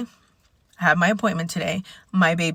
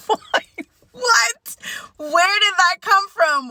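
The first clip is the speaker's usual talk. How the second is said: higher, louder, puzzled at herself; bewildered outburst, much higher; mock surprise; 13 semitones higher, 4 dB louder, slower than usual. Real, not acted. Vlogger baffled by her own singing, high and loud. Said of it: "why did that song just come in my head".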